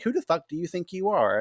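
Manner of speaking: high, indignant demand of who she thinks she is; mock outraged demand; indignant challenge. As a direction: push back hard, offended. Man challenging her authority.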